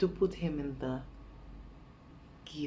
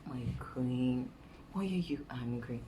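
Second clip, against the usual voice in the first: imitating a low, coaxing husband; soothing flattery; 4 semitones lower, 3 dB quieter than usual. Husband praising his angry queen, soothing flattery. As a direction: lay on gentle praise in a lowered voice. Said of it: "when I'm angry, he's like".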